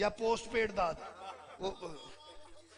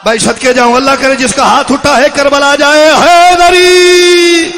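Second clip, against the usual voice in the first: roaring blessing into a long held cry; loud rallying call, last word drawn out high; preach; 5 semitones higher, 26 dB louder, slower than usual. Real, not acted. (पूरे ज़ोर से लंबा नारा)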